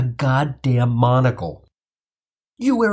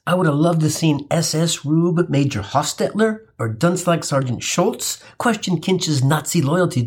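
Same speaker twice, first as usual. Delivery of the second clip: usual speaking voice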